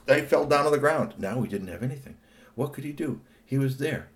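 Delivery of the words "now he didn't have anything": ordinary delivery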